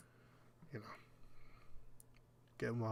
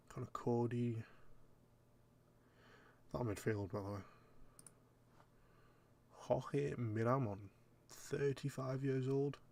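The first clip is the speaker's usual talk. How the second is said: ordinary delivery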